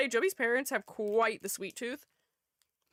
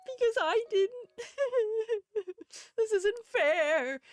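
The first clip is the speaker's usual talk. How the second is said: mock whining; 12 semitones higher, slower than usual. Whining about losing at bingo.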